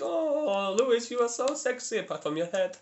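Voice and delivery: a hint of a cheap Borat-style accent; mock girlfriend accent. Quoting his girlfriend's teasing in a mock accent. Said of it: "She says".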